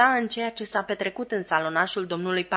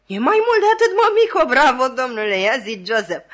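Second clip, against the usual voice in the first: higher, louder smug crowing; superior laugh; 5 semitones higher, 8 dB louder than usual. A narrator's reading. Pumblechook crows to the sister, smug and loud. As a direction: lord it over them with a smug laugh. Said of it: "râzând cu superioritate".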